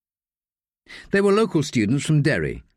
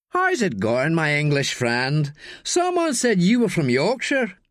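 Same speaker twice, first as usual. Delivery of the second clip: put-on sneering drunk student; 6 semitones higher, slower than usual. Drunk student pressing Daniel to fetch beers, high jeering voice. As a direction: lean in and goad him like a drunk bully.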